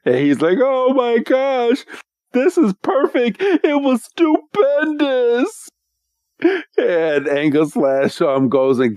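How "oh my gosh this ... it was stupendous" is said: high, slow, hammed-up blubbering; mock whining cry. Trial master moved by the heroes' success, mock crying. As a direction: overcome and wailing, played for comedy. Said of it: "just starts crying and whining again".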